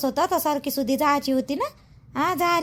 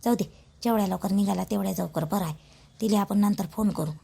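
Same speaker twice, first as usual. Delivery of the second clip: hushed pleading; 8 semitones lower, 6 dB quieter than usual. Acted. Mother soothes the son quietly.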